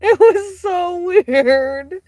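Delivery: slow, high and loud through laughter; giggling "so weird"; laughing disbelief. Real, not acted. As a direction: stretch it out, still laughing.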